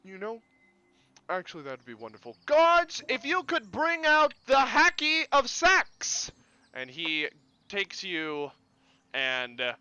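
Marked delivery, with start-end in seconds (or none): 2.4-6.3 high, loud, slow summons; commanding call. Acted. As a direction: call out to the guards with pomp. Mayor summons guards.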